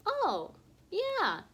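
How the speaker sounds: sudden high "Oh, yeah"; delighted recognition. Acted. Teacher realises the word for the kids. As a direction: pop up with pleased recognition.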